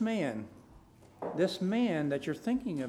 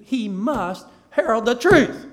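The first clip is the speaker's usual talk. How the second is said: slow, loud, high declaration; forceful pulpit proclamation landing hard on "truth"; emphatic preaching; 5 semitones higher, 15 dB louder, slower than usual. Real, not acted. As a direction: punch "must" and shout "truth". Preacher returns to emphasised main point.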